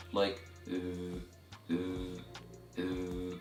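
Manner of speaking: "uh" with the tongue between the teeth, voiced; voiced dh sound. Shown as a demonstration. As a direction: buzz a long voiced "th" as in "the". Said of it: "you can feel the vibration in your vocal cords".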